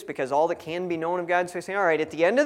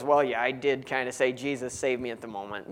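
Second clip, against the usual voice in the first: sheepish, trailing mumble that drops off softer; embarrassed admission; 6 semitones lower, 5 dB quieter than usual. Retold or imitated. Churchgoer sheepishly admitting his foxhole prayer, embarrassed mumble. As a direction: own up to it half under your breath. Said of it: "everybody embarrassedly says".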